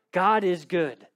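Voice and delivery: ordinary delivery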